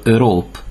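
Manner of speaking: Europe in French, starting on a rounded ö; French Europe, rounded first vowel. Shown as a demonstration. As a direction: say Europe as "ö-rop". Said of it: "általában Ö-nek ejtjük".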